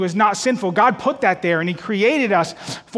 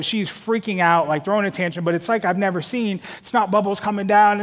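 ordinary talk

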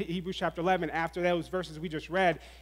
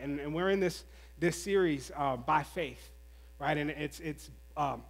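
ordinary delivery

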